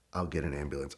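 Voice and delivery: very low, hushed deadpan officer; stoic officer. Retold or imitated. Officer answering the driver at the car, stoic. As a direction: drop low and quiet, playing the unmoved cop. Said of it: "he's just stoic and he says".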